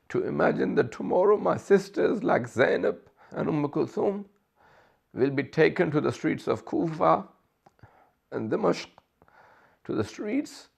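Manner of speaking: high, straining voice close to weeping; tearful lament. Real, not acted. Preacher voicing Imam Hussain's dread for his sisters, tearful. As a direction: plead through rising tears.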